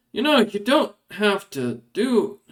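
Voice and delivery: mildly weary complaint, drawn out; lightly acted exasperation; exasperated guard. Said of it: "looks at you and sighs and goes".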